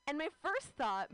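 usual speaking voice

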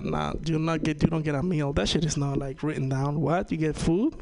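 ordinary talk

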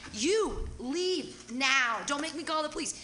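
drawn-out command, then high-pitched threat; retold yelling. Host retells ordering the guy to leave, angry and emphatic. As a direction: re-enact ordering someone out word by word. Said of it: "I got to yell at dirty hippies".

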